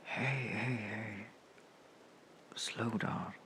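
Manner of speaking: whispered, gently calming; soft whisper. Acted. Friend calming a panicked comrade, whispered. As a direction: hush them down softly.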